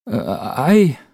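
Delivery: hoch und laut, stockend; aufgewühlter Cameron. Acted. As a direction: den Tränen nah herausgebrochen. Cameron shaken, near tears. (Cameron, erschüttert nach dem Beinahe-Sturz)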